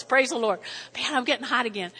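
normal talking voice

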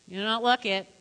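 ordinary talk, nothing marked

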